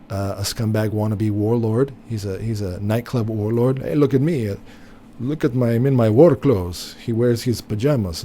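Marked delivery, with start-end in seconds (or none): 3.8-7.0 a light, hammed-up Eastern European accent, louder on the war clothes; mock Zelensky accent. Mocking the Ukrainian leader for his viewers, lightly put-on Eastern European accent. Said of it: "that's my Zelensky".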